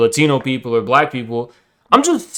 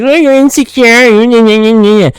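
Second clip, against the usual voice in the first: much higher, loud whiny parody; mocking nerd voice; 9 semitones higher, 5 dB louder than usual. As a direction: sneer at the critics with a mock whine. Mocking what nerds say.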